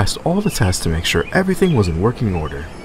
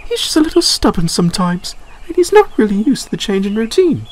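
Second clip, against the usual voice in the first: Clarabelle voiced; 13 semitones higher than usual. A narrator's reading. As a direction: reassure the newcomer. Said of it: "Clarabelle replied".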